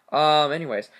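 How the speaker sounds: ordinary delivery, nothing marked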